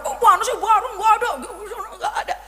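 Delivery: high, fussy complaining of a man who can't find it; helpless husband. Retold or imitated. Husband searching for something he cannot find, high and fussy.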